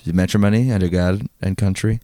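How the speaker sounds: ordinary delivery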